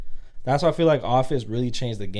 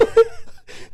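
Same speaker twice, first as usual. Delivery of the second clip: high squeaky laugh; 7 dB louder than usual. Real, not acted. Laughing at question.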